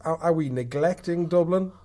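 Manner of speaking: usual speaking voice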